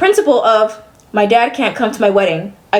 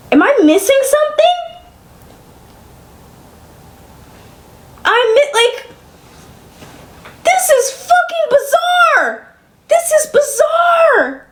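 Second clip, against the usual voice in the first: incredulous rising cry; 13 semitones higher, slower than usual. Real, not acted. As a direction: voice climbing high in disbelief. Venting in disbelief, voice cracking.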